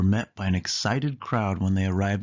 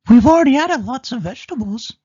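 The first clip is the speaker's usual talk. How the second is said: much higher, much louder and fast mouse voice; Minnie voice; 11 dB louder, faster than usual. Acted. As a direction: exclaim it in a rush as Minnie. Minnie protests to Goofy.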